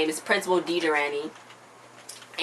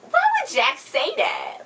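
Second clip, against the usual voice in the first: shocked; slower than usual. Real, not acted. Shocked reaction to Jax.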